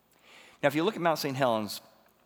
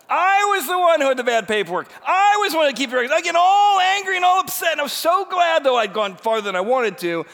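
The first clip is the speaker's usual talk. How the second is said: high, loud comic outburst; hammed-up self-blaming shout, easing at the end; shouted confession; 11 dB louder than usual. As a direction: yell it out in exasperation at yourself. Confesses own mistake after angry rant.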